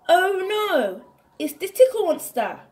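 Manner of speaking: high, loud and fast playful fright; mock alarm. Warning the children at the picnic, fast mock alarm. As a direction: pretend to panic for the kids.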